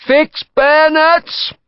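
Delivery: high, long-drawn parade-ground shout; shouted command. A narrator's reading. Order shouted from just behind the eighty-seventh, drawn out. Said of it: "The shout came from just behind".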